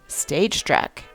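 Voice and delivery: ordinary talk, nothing marked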